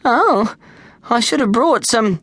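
put-on high boyish dismay. Snag regrets no sunblock, high and dismayed. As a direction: say it with little worry.

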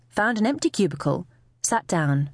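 usual speaking voice

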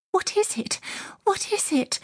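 very high, frightened woman's questions; alarmed gasp. A narrator's reading. Missus Lennox hearing wailing from the servants' quarters, alarmed gasp. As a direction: gasp it out, pitched far up. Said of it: "Missus Lennox gasped".

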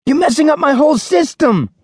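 high, loud fussy wail; shouted complaint. Acted. Tidy student chases the girl wrecking his system, fussy shout. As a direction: cry out that your order is ruined. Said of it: "Wasabi shouted".

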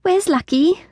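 high frightened squeak; squeaked with fear. A narrator's reading. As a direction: squeak it out, scared. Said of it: "squeaked with fear".

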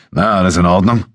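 offhand, almost too casual; a casual check-in after the fight. A narrator's reading. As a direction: toss the question away lightly. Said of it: "fragte er in beiläufigem Ton".

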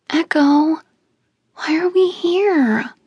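put-on high, slow childish whine; young girl tugging at her cousin, whining; whiny little girl. A narrator's reading. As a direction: whine like a small scared cousin. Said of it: "Izzy whined".

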